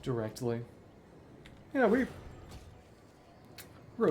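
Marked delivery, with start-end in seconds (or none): 1.7-4.1 a quick excited burst; excited yeah. Real, not acted. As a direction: blurt it out, thrilled. Excited at reaching right level.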